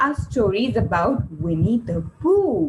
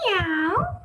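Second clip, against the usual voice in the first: cat meow; 6 semitones higher than usual. Retold or imitated. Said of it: "So the answer is".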